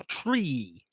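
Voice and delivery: "Tree" with a long, falling ee; over-articulated TREE. Shown as a demonstration. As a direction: show the wide ee in "Tree", held long. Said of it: "Look at the mouth".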